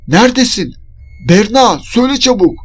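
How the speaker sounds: high, urgent shout. A narrator's reading. Searching for her missing friend, shouting urgently. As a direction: call out demanding an answer. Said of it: "diye bağırdığımda".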